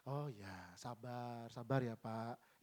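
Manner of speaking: soft, low, slow imitation of a patient person; hushed mock-patient reassurance; show-off patience. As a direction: soothe with exaggerated patience, very softly. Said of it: "sok sabar gitu".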